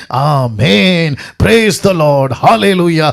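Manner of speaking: long drawn-out mock amens; slow, drawn-out imitation of churchgoers; imitated congregation response. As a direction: stretch each praise word out slowly, mimicking worshippers. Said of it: "तो आप क्या करते हैं?".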